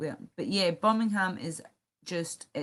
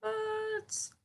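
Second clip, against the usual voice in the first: squeaky bird voice; 14 semitones higher, 4 dB quieter, much slower than usual. Pretend. Squeaky repeated birds, parrot.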